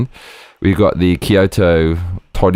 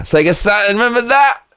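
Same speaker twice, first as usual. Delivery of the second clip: much higher, excited recall; excited nostalgia; 12 semitones higher than usual. Real, not acted. Pointing out the Sega Saturn, a high excited squeal. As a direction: squeal the name with delight.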